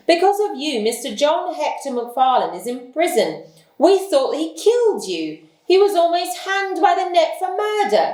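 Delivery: angry. Acted. Detective scolding the hiding builder, angry and high. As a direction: pile the blame on him, pitched high. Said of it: "repeated Lestrade angrily".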